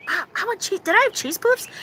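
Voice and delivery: stoned craving. Retold or imitated. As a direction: retell your dazed self wanting a snack. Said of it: "And I'm going".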